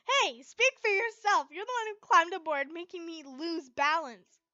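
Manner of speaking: loud annoyed comeback; Gray retorts. Acted. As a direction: push the blame back at him. Gray arguing back at Natsu.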